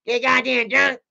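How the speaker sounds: high, fast cartoon kid voice; squeaky bratty cartoon brat; Cartman voice. Mocking his brother as a bratty cartoon kid, high and fast. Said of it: "He talks like Cartman".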